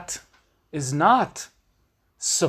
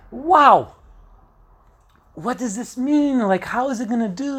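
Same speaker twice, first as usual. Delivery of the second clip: high, loud wow, then worried questions; surprised wow; 7 semitones higher, 4 dB louder than usual. Retold or imitated. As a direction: catch the news off guard. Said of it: "It's like".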